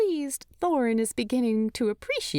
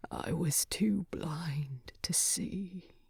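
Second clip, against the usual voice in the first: faint, slow, breathless whisper; a dying dwarf confessing; 2 semitones lower, 8 dB quieter, slower than usual. Acted.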